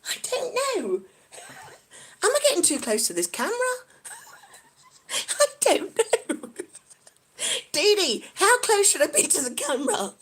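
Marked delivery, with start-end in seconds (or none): 0.1-2.2 high-pitched, laughing words; giggling. Real, not acted. Streamer unsure what viewers think, giggling. 5.1-7.7 high, slow, drawn out through laughter; real laughing. Real, not acted. Streamer too close to the camera, laughing.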